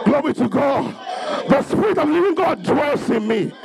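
ordinary talk, nothing marked

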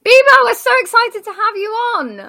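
high, loud welcome; excited welcome. Real, not acted. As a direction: announce her name with a grin. Hyped welcome for the guest.

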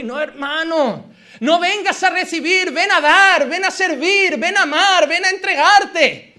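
loud, driving sermon delivery; rousing the church to serve; fiery preaching. Acted. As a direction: preach it out with full force. Preaching climax.

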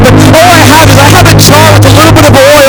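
preaching. Acted. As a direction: push the message home to the youth. Preaching over music.

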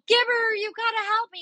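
high-pitched imitated begging; mock panicked plea, pitched high; desperate plea. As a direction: beg your brother to save you, voice way up. Begging brother at the door.

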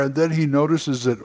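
usual speaking voice

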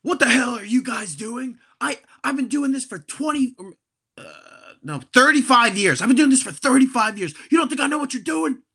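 put-on ranting old attendant who's seen it all; hammed-up furious bathroom attendant; angry attendant. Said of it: "he starts being like".

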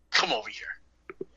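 slow hushed whisper; menacing whisper. Retold or imitated. Teacher quietly calling the kid to his desk, slow whisper. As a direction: beckon him over in a low hiss.

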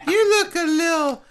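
high, slowed-down bartender voice; mixologist character. Pretend. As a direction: play a drawling, high-pitched mixologist. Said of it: "call him a mixologist".

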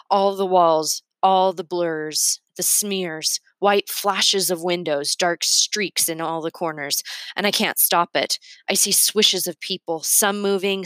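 ordinary delivery

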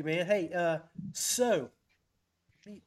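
usual speaking voice

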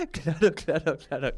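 claro said through real laughter; chuckling while agreeing; laughing. Real, not acted. Host laughing at her own Maradona joke, giggling.

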